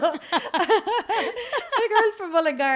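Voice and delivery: laughing it out, high and slowed; giggling through the words at herself. Real, not acted. Guest joking about her own small-town roots, laughing.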